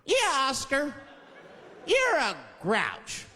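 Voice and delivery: put-on high, slow taunting child; children's chorus. Kids on Sesame Street judging Oscar, high drawn-out taunt. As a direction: draw it out high like mocking kids.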